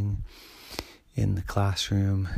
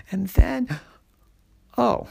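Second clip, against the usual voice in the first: much louder, higher burst of surprise; startled outburst; 11 semitones higher, 9 dB louder than usual. A narrator's reading. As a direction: jump at the big reveal. Sudden reveal of the rustling.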